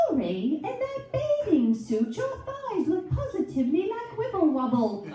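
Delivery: mock snooty British reality judge; British accent. Inner voice as British judge, mock snooty British accent. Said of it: "changing my inner voice to be British".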